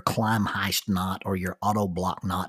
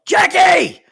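a big, high, much louder shout that falls at the end; full shout; 11 dB louder than usual. Retold or imitated. Swinging out from the tree, shouting for his wife: a big, high shout. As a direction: throw the name as far as it will go. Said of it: "swing way out and yell".

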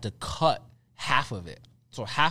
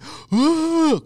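high, loud gasping sob; hammed-up wail of pain; imitated crying; 7 dB louder than usual. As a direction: mimic a big crying gasp. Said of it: "I'm actually physically".

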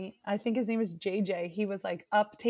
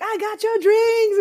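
high, loud, slow call; cheery server; 10 semitones higher, 9 dB louder than usual. Retold or imitated. Waitress joins the cheering, high loud call. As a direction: bring the drinks cheering. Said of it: "came up and was like".

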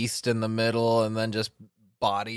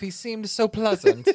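much higher and louder, laughing; high mock neighbour voice; 13 semitones higher, 4 dB louder than usual. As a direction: gossip in a high voice.

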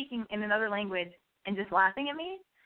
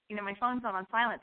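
ordinary talk

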